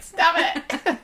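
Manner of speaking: high squeak, laughing through it; high laughing mock plea. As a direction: plead stop through laughter. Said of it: "he'd be like".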